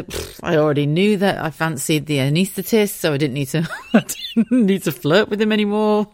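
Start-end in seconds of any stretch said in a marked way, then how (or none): 3.9-6.1 laughing. Real, not acted. Laughing through the joke.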